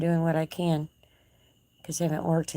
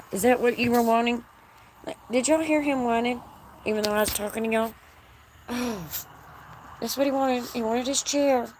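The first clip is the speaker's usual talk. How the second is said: high sing-song pet talk; 7 semitones higher than usual. Real, not acted. Talking to her pet horse.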